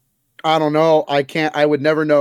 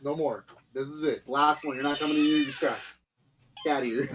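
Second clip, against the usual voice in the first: quiet scolding; 10 dB quieter than usual. Real, not acted. Host ordering his dog out mid-show, hushed and firm. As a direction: lay down the law under your voice.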